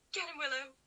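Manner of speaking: root for the puppy; cheering the dog. Real, not acted. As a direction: gasp then high excited cheer for the dog. Cheering dog after a gasp.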